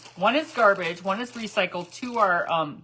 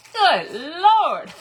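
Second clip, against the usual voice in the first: loud, high "Good Lord" with a laugh; shocked exclamation; 9 semitones higher, 10 dB louder than usual. Retold or imitated. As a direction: gasp it out at the price, laughing. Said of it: "I was like".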